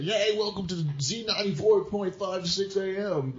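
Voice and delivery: high, bright-sounding radio host impression; chirpy morning DJ greeting. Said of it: "Did you have that radio voice?".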